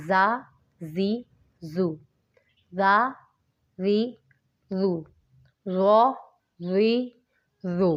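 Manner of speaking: زا hard with a whistle, ذ soft without; whistled زا against soft زال. Shown as a demonstration. Teacher drilling ز ذ ظ for students, careful contrast. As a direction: hiss the ز, keep ذ soft. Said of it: "زا کو سختی سے پڑھیں گے".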